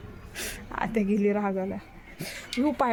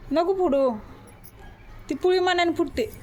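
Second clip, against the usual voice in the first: mother snapping at her daughter to stop, firmly raised; firm scolding; 8 semitones higher, 3 dB louder than usual. Real, not acted. Mother telling daughter not to pop the pimple, sharp and louder.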